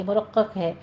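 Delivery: usual speaking voice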